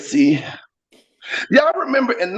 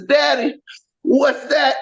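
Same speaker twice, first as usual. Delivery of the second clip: a hint of a curious child, higher; lightly voiced little daughter; 7 semitones higher, slower than usual. Daughter in the back seat asking daddy, lightly childlike. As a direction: ask like a small kid. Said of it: "my daughter in the back seat says".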